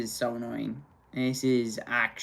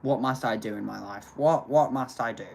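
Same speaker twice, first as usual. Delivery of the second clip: ordinary delivery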